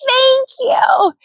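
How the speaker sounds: very high mock-teen shout; high, overjoyed cry in a put-on voice; excited squeal. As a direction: imitate a girl squealing with gratitude. Imitates the overjoyed girl shouting thanks.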